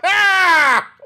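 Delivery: high, loud burst of real laughter; real shrieking laugh of disbelief. Real, not acted. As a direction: let out a stunned shriek of a laugh. Laughing at the fake [name] reveal.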